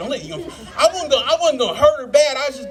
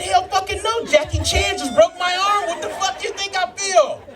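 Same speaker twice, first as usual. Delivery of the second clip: high, indignant outburst; outraged; 8 semitones higher than usual. Retold or imitated. Husband answering the hospital worker about his wife, high and outraged. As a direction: blast it up high, fully outraged.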